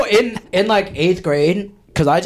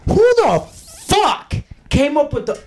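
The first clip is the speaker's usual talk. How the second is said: higher, half-laughing indignant outburst; ranting about homework, much higher and exasperated; getting angry; 12 semitones higher than usual. Real, not acted. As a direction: let real annoyance rise in pitch. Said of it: "I'm kinda mad now".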